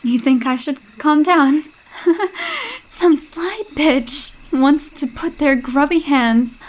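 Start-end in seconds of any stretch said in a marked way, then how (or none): 2.0-2.8 short scoffing laugh; mocking laugh. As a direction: laugh off the idea of calming down. Mocking the call to calm down.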